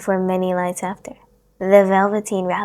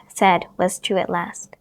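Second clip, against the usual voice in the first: usual speaking voice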